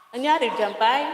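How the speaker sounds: calling to Jampay about the noise outside, loud. Real, not acted. Loud call.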